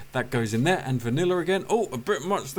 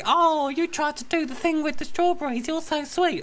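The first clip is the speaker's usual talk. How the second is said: high, touched gushing voice; gushing partner; 12 semitones higher than usual. Retold or imitated. Partner seeing the strawberries, high gushing coo. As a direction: coo at the sweet gesture. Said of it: "will see the strawberries and say".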